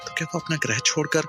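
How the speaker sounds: plain reading voice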